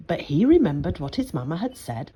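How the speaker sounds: usual speaking voice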